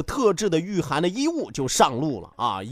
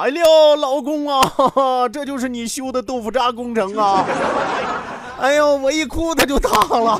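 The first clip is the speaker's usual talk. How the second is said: mock wailing scold; 5 semitones higher, 4 dB louder than usual. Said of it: "一边哭一边骂呀".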